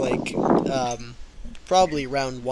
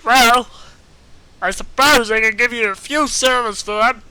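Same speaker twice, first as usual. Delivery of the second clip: high loud squeaky elf; tiny elf haggling; 7 semitones higher, 9 dB louder than usual. Acted. Elf voice continues.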